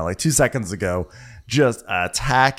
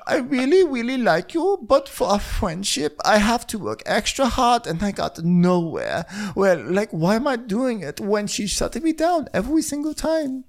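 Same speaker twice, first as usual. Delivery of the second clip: Diana; 14 semitones higher than usual. Retold or imitated. Diana explaining her hard work on friendship, high mock pleading. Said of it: "So Diana goes".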